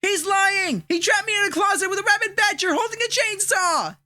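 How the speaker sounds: high, soft, far-off protest; muffled from a closet. Acted. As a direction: call out from behind a door.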